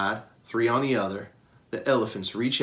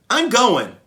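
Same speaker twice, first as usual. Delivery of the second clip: higher, louder firm declaration; determined; 11 semitones higher, 9 dB louder than usual. Acted. Young Ruby insisting to Okello, determined. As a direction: set her foot down. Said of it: "in her most determined voice".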